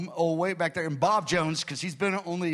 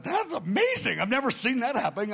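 higher, astonished exclamation; amazed; 6 semitones higher than usual. Retold or imitated. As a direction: gasp it out, amazed by what happened. Pastor astonished inner thought.

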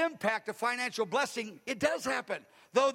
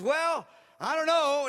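higher, louder, slower doubtful woman; hesitant woman's voice; 5 semitones higher, 5 dB louder, slower than usual. Retold or imitated. Woman on the phone doubts the pastor's advice, high and hesitant. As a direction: hold back with a doubtful, high voice.

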